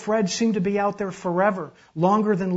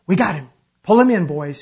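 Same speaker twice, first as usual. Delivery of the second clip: loud, urgent shout to a crew; called-out order; 2 semitones higher, 12 dB louder than usual. A narrator's reading. Uncle orders the men to haul in the swimmer, shouting. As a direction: call out over the din.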